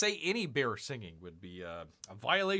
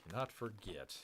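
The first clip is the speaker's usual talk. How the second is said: cannot forget said softer and lower; a quiet, lower echo; hushed repeat; 8 semitones lower, 12 dB quieter than usual. Acted. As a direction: drop the voice for suspense.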